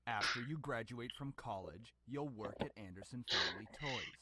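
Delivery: softly spoken, restrained father; quiet, weary sigh. Acted. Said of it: "sighed quietly".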